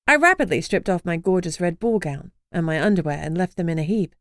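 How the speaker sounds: ordinary delivery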